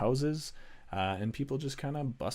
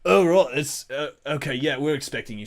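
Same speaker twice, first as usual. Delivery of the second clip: higher, louder flustered guard; sheepish guard; 3 semitones higher, 8 dB louder than usual. Acted. Guard realising he threatened expected guests, sheepish. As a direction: back down with an awkward apology. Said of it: "the guy goes".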